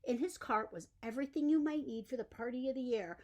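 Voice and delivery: usual speaking voice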